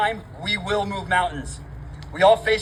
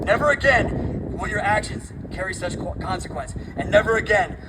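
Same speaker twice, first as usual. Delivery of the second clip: roaring rallying speech; 3 semitones higher than usual. Real, not acted.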